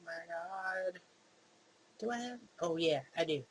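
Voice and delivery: normal talking voice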